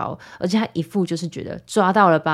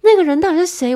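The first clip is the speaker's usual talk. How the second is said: much higher and louder, an appalled outcry; disbelief; 12 semitones higher, 8 dB louder, faster than usual. Real, not acted. Recalling her old self, a shrill loud outcry. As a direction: yell it as if you can't recognise yourself.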